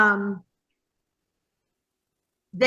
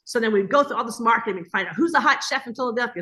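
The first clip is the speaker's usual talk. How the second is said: normal talking voice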